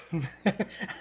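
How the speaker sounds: short real laugh; amused laugh. Real, not acted. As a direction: laugh at the joke. Reacts to Ratatouille joke.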